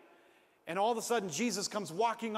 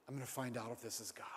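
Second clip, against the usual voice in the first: quiet resolve; 6 semitones lower, 8 dB quieter, faster than usual. Retold or imitated. Peter's inner resolve.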